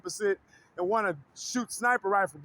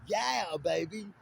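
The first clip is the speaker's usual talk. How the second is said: lower, smug groovy British voice; Austin Powers; 5 semitones lower, 6 dB quieter, slower than usual. Retold or imitated. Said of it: "Austin Powers' voice".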